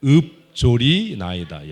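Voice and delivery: loud, drawn-out model of "읊조리나이다". Shown as a demonstration. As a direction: sound out "읊조리나이다" slowly and loudly, syllable by syllable. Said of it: "읊조리나이다가 발음이 안 돼?". ("읊조리나이다"를 크고 길게 또박또박)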